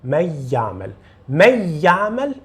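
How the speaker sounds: من يعمل merged to a short y with no nasal hum; idgham without ghunna. Shown as a demonstration. Teacher warns what not to say, quick non-nasal من يعمل. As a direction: glide straight from من into يعمل, not holding the nasal. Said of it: "Não diga".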